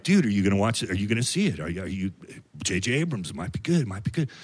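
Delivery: low, soft stoner buddies pleading; a hint of dopey friends coaxing; lightly voiced stoner friends. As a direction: mumble like a hopeful dude.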